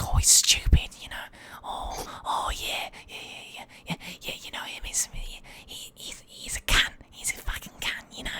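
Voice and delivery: imitating conspiratorial whispered insults; whispered gossip. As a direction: whisper as if badmouthing someone nearby.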